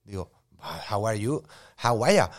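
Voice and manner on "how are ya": louder, run-together Aussie "ya"; casual imitated Australian greeting; Australian accent. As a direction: slur it into an Australian "how are ya". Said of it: "do your best Australian accent".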